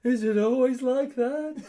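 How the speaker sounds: high, slow, loud dazed question; mock shell-shocked. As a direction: squeak it out like a stunned survivor. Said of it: "shell shocked".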